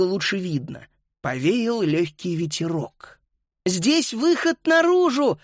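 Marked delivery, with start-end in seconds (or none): 3.6-5.4 very high, slow joyful cry; overjoyed. A narrator's reading. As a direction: cry out with joy, stretching it. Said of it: "обрадовалась Фрида".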